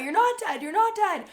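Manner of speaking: high, pleading repetition; frantic mom. Retold or imitated. Mom holding her dazed daughter, repeating high and frantic. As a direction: keep insisting she's alive, panicked. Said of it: "freaking out".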